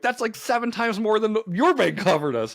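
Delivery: high-pitched excited quote; super excited. Retold or imitated. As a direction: imitate someone thrilled by the number. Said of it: "Just, like, super excited".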